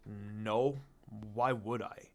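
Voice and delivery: low, slow, detached dismissal; cold. Acted. Sasuke brushing off the teacher's question, coldly. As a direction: keep it cool and shut the question down. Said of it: "he said coldly".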